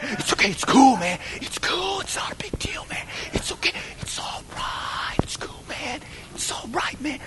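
imitated urgent whisper; hushed placating. Classmate caught with a bag, hushed whispered placating. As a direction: whisper it to smooth things over and keep it secret. Classmate hushing him about marijuana.

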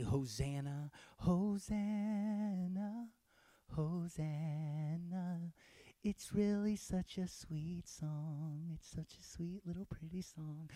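soft, drawn-out mock-sweet Hosanna; sweet little lyric. Preacher mocking Hosanna as a pretty song, sweetly. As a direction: croon it softly, slowly, like a pretty little song. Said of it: "is not a sweet little lyric".

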